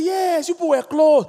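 high put-on reply; voicing a comforting friend. Pastor voices the listener.

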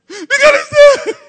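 high, loud wail; mock crying. Mourner crying out from the gate, mock wailing. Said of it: "some people will start crying".

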